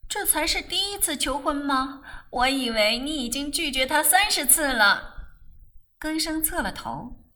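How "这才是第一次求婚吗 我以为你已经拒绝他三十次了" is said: high-pitched, lively disbelief; astonished. A narrator's reading. Rose learns it's a first proposal, astonished. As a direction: ask in bright-eyed amazement, voice lifted. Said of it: "他诧异地问道".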